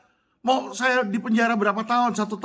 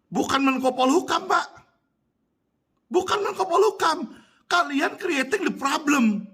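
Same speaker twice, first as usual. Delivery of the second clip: high-pitched, emphatic accusation; indignant rebuke; 5 semitones higher than usual. Real, not acted. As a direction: throw the blame at them, pitched high. Heated rebuke of the minister. (teguran geram bernada tinggi)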